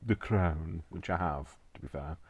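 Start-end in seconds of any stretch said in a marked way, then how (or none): 0.0-0.8 lightly put-on royal British accent; playful mock Queen's English accent. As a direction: speak as if out of The Crown, in Queen's English. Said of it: "I do sound a little bit like".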